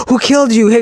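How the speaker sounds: overacted, breathless deathbed drama; mock death scene. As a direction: gasp over someone dying, theatrically. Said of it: "somebody's dying and they're like".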